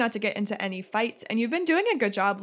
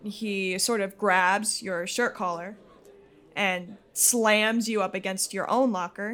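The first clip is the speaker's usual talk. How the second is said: normal talking voice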